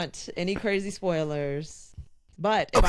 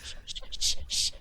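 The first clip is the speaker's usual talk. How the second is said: sharp unvoiced shushes in a row; shushing; 4 dB quieter than usual. Real, not acted. Podcaster hushing her teasing co-hosts, repeated shushing. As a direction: hush the room to get back to your story.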